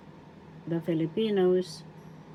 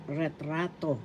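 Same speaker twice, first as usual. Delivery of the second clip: retrato accented on the middle syllable; stress on RA; 5 dB quieter than usual. Shown as a demonstration. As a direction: lean on the middle of retrato: ret-RA-to. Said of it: "is in the second syllable".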